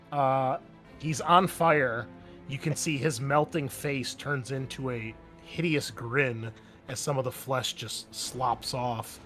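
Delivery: normal talking voice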